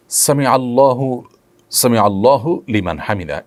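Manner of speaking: আল্লাহু sounded with a clear final u; careful teaching demo, আল্লাহু ending in hu; correct pronunciation. Shown as a demonstration. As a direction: demonstrate আল্লাহু with its vowel ending intact. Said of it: "এটি হলো শব্দের সঠিক উচ্চারণ".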